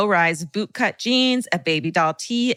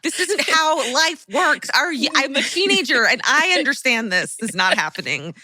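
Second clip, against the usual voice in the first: put-on exasperated teen; 7 semitones higher than usual. Voicing teen scolding mom.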